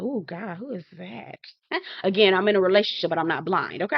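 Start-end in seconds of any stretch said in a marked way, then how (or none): 0.0-1.4 quietly smitten aside; hushed admiration. Retold or imitated. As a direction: murmur it on noticing someone handsome. Reacts to a handsome man.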